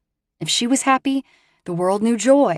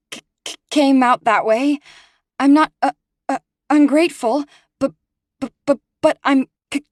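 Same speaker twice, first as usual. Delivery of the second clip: nervous stammer, higher and louder; tripping over every first sound; frightened, halting stutter; stuttering apology; 7 semitones higher, 5 dB louder than usual. Acted. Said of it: "The stutter did her in".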